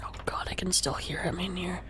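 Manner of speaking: soft breathy whisper. Real, not acted. Player whispering in the dark house, hushed. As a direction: whisper so as not to wake anyone. Whispering again late at night.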